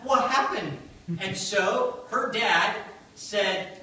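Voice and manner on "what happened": mock shock. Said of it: "and you're like".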